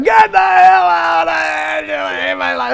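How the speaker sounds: put-on drawn-out, high-pitched drunken yell; hammed-up agonized holler; retold furious shout. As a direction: scream the roommate out of the room, stretching the words. Retold yelling at roommate while puking.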